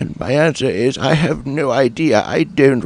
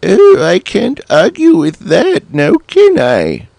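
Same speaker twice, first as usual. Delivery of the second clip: high, squeaky cartoon cat, puzzled; 9 semitones higher, 6 dB louder than usual. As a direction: squeak it out like a small confused cat. Cat figure gives in.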